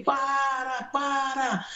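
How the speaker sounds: high cry of para; shout. Retold or imitated. Preacher retelling crying out to the doctor, high mock shout. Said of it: "Eu gritei".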